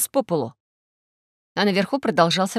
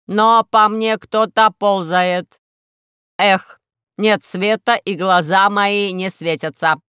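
robot; 5 dB louder, slower than usual. Acted. Robot complains in dark.

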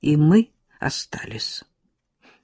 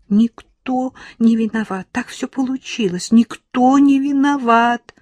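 high, slow, wailing protest; crying out; 8 semitones higher, 4 dB louder than usual. A narrator's reading. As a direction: wail it like a distraught girl. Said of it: "заплакала она".

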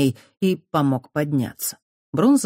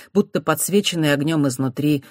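ordinary delivery

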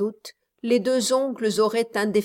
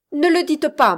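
higher and louder, begging him to stop; pleading cry; 5 semitones higher, 6 dB louder than usual. A narrator's reading. Miss Campbell begging Ursichlos to stop, loud plea. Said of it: "s'écria Miss Campbell".

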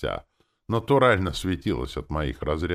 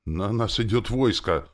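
louder, higher, drawn-out alarm; panicked thought; 7 semitones higher, 5 dB louder than usual. A narrator's reading. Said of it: "подумал я панически".

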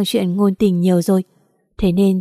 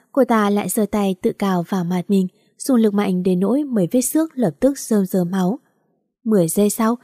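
usual speaking voice

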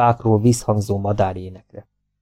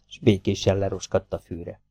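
usual speaking voice

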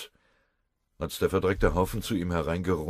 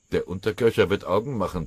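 cheerful blustering; 4 semitones higher, 7 dB louder than usual. A narrator's reading. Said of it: "polterte Rosskamp vergnügt".

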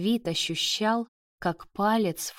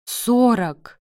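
loud, raised cry of despair; almost wailing; 4 semitones higher, 8 dB louder than usual. A narrator's reading. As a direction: nearly howl the answer. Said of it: "уже чуть ли не выл". (почти воя, в отчаянии)

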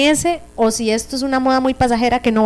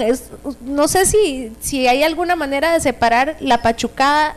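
normal talking voice

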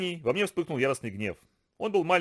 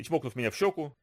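usual speaking voice

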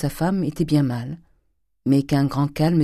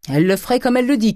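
fast, high, loud warning; crying out; 9 semitones higher, 6 dB louder, faster than usual. A narrator's reading. Servant warning her master about his daughter, crying out. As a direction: blurt it out in a raised voice. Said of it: "cria Nanon".

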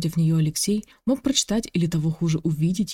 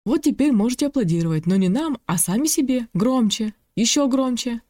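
hyped-up host; 6 semitones higher than usual. Acted. Host urging applause.

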